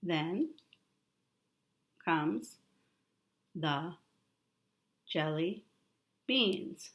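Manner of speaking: each word alone, long pauses between; slow word-by-word reading for a child. Shown as a demonstration. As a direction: point and read one word at a time. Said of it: "You read it".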